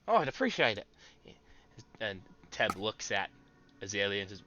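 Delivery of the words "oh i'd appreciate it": gnome voice. Acted. Gnome Teb replies.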